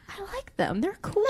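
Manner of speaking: mock fangirl voice. Host imitating her younger self watching the talent show, in a high gushing voice. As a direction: squeak it like a shy admirer. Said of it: "I was like".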